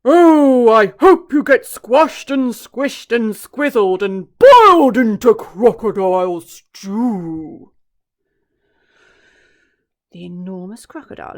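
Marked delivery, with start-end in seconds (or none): 0.0-7.7 much louder, very slow outraged booming; cried out. Acted. Elephant crying out at the croc, loud and outraged. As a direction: roar the insult slowly.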